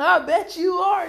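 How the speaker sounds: slow high sly repeat; knowing tease. Retold or imitated. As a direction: tease again with a grin. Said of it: "They be like".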